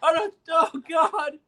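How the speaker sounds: high, laughing mock despair; crushed self-doubt. Man brought back to reality, high mock despair. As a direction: wail it in hammed-up regret. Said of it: "You leave, I'm like".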